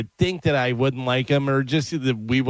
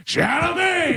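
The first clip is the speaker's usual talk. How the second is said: comic shouted greeting, pitched up; shouted name; slower than usual. Retold or imitated. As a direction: bellow the name across the room. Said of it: "he goes".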